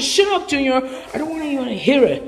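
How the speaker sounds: ordinary talk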